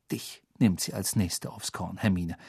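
low, soft warning; hushed, concerned voice; quiet, worried. A narrator's reading. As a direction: warn a friend under your breath. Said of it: "sagte Ron mit leiser, besorgter Stimme".

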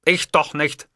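mildly mocking reply; scornful. A narrator's reading. Boss answering his secretary about ringtones, mildly scornful. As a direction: brush it off with light scorn. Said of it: "hatte ich spottend erwidert".

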